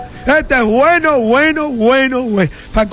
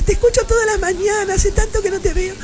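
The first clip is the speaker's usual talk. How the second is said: high, soft imitation of a woman; female friend; 8 semitones higher, 4 dB quieter than usual. Woman friend telling the host she listens, in an imitated high voice. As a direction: put on a small high woman's voice. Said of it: "encontré una amiga mía".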